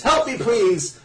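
high-pitched mock panic; retold scream for help. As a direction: imitate a desperate plea, half-shouted. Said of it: "he screams".

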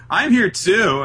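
hammed-up attention seeker; mock needy pipe-up. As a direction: wave for attention like a left-out kid. Voicing Noah wanting attention.

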